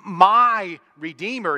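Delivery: "my Redeemer" loud, high and slow; loud, high, slow insistence on "my Redeemer"; emphatic. Acted. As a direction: hammer "my", drawn out and loud. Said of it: "very emphatic, clear statement".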